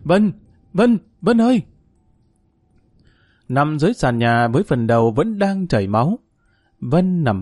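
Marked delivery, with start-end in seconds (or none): 0.0-1.6 high, loud anguished scream of her name; desperate scream. Acted. Husband finding his wife bleeding on the floor, screaming. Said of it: "Tuấn gào lên".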